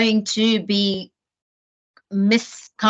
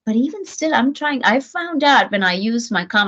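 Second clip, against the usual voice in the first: normal talking voice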